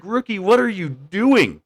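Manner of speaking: high, incredulous call to the rookie; firefighters yelling at rookie. Retold or imitated. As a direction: shout across at the new guy. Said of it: "And they're like".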